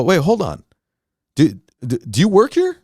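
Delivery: sudden high-pitched doubt; surprised question. Retold or imitated. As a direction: stop short and ask, puzzled. Realises she isn't staff.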